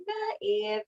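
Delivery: high-pitched, playful brush-off; mock dismissive. Snake talking Eve into the fruit, mock dismissive. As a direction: wave the worry away in a high mock voice.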